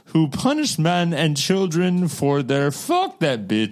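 bad Russian accent, breaking into laughs; mock Russian accent. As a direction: keep the hammy Russian accent going. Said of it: "I can't do a Russian accent".

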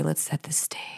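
hushed, whispered scene-setter; soft whispered lead-in; stage whisper. Pretend. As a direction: whisper it to open the story. Opens the story in a whisper.

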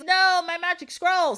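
high wail of villainous dismay; mock dismay. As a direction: cry out as if robbed of a treasure. Villain loses his scroll.

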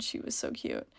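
breathy gushing whisper. Real, not acted. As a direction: whisper it, overwhelmed by cuteness. Gushing over little girl.

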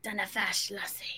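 soft mock Scottish accent. Outlander fan plays at Scots, soft Scottish accent. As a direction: drop to a quiet put-on Scottish lilt. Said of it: "those things start to get in my head".

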